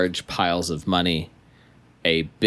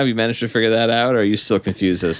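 fast teasing; 5 semitones higher, 4 dB louder, much faster than usual. Acted. Excited taunting about Dorothy.

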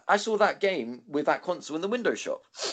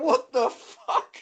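high, drawn-out disbelief; retold outburst at a friend, high shocked squeal; 5 dB louder, much slower than usual. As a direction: shriek it out, stunned. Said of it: "I was like".

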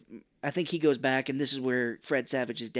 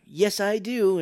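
mock proud answer; 7 semitones higher, 7 dB louder, much slower than usual. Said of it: "And she's like".

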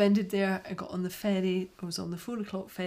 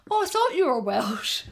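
loud, higher-pitched retold surprise; imitated surprise; 7 semitones higher, 10 dB louder than usual. Festival-goer mistaking her accent, imitated in loud surprise. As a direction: imitate someone caught out by a wrong guess.

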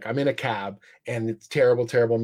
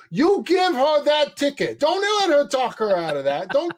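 high, loud bellow out the truck window; high, loud shout at the cop from a stuck truck; trucker yelling; 12 semitones higher, 4 dB louder than usual. Retold or imitated. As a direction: yell it like a trucker fed up in traffic. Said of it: "sticks his head out the window and goes".

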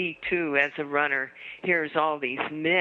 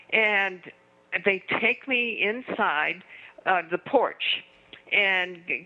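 ordinary talk, nothing marked

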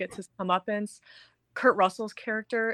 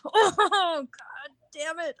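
high, relieved mock laugh-cry; imitated laughing and crying; 9 semitones higher, 3 dB louder, much slower than usual. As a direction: act out laughing through tears, high-pitched. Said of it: "laughing and crying at the same time".

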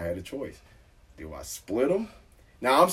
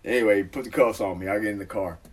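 ordinary delivery

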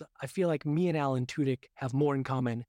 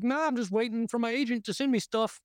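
high, drawling slacker voice; lazy and unbothered, pitched up; lazy lounging voice; 9 semitones higher than usual. Pretend. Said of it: "to just being like".

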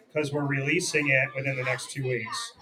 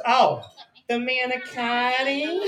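higher, slow mock waitress with full "manicotti"; mimicked waitress; 7 semitones higher, slower than usual. Imitating waitress finally understanding the order, mocking and slow. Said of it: "she said it like this".

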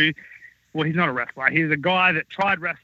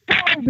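imitated crash; 11 semitones higher than usual. Crash after the angry shout.

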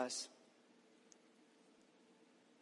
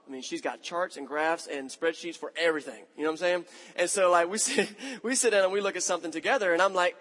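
ordinary talk, nothing marked

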